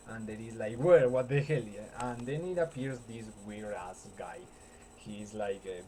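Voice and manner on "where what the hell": sudden loud startled where; startled shout. Retold or imitated. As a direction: blurt it out in shock. Mister Buttons shocked in retold scene.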